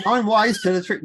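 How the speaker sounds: high, loud Australian accent. Shown as a demonstration. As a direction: say it like an Aussie. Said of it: "if you're doing an Australian accent".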